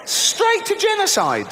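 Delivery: incredulous rant. Real, not acted. Ranting at God's overreaction.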